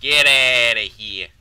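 loud, slow yelled dismissal; long, booming send-off; drawn-out yell. Acted. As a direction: bellow it, stretching out the words.

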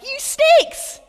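high, loud, playful shout of outrage; hammed-up cry of betrayal; acting out the other disciples rounding on James and John, mock indignant shout; mock indignant outburst. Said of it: "the other disciples were like".

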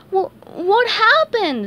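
frightened squeaky question; anxious. Acted. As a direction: stammer it out nervously. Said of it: "SpongeBob asked anxiously".

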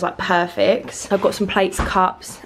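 ordinary talk, nothing marked

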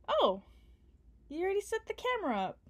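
quick happy surprise at the set-up; pleasantly surprised. Acted. Surprised camera is already set.

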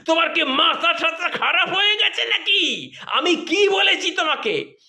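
hammed-up shrill wife yelling; mock shouting wife. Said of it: "চিৎকার করে উঠলেন".

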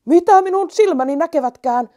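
high, loud astonished cry; surprised shout. Acted. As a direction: shout in disbelief. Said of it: "huusi yksi hiiristä". (hämmästynyt huuto)

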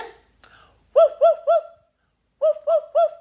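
high, loud woof woofs; dog barking. Shown as a demonstration. Teacher showing children dog noise, loud barking. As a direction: bark like a playful dog. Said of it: "what noise does a dog say".

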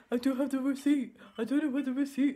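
mock her partner's whine; whiny mock Jamie. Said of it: "And he goes".

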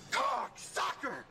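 slow, drawn-out high yell; stretched-out furious shout; shouted insult. Acted. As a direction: hurl the insult after them. Insult shouted after losing ticket.

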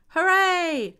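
high, loud hooray; joyful storybook cheer; children's cheer. A narrator's reading. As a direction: cry out like a delighted class. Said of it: "cried the children".

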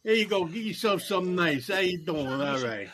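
lightly put-on Italian-American voice; a hint of Italian wise guy; Italian guy impression. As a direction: play a generous Italian guy, starting high and loud. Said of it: "that's, like, so Italian".

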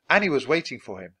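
ordinary delivery, nothing marked

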